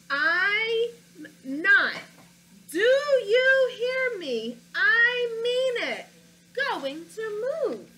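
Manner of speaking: loud, high, slowed-down defiant yell; angry shout. Acted. Teacher voicing the boy angrily refusing to move, shouting. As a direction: shout it like a furious kid refusing. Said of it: "Wow, he sounds angry".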